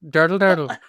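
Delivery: silly stage-Irish babble; bad Irish accent. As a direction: ham up a fake Irish lilt. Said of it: "That's not what you do to Irish".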